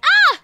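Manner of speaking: short, high, loud yelp; startled scream. Acted. Girl surprised by Isaac appearing, startled scream. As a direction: jump with a sudden fright. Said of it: "Isaac, you startled me".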